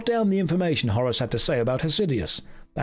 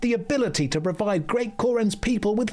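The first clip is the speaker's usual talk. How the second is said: higher, proud storytelling shopkeeper; higher, hammed-up NPC merchant voice; Kadikarus impression; 5 semitones higher than usual. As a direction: boast about the city like a cheerful merchant. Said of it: "be doing a Kadikarus impression".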